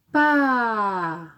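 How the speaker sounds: stretched "Pa" sliding steadily downward; drawn-out syllable. Shown as a demonstration. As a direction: hold "Pa" on a long falling glide. Model answer in syllable game.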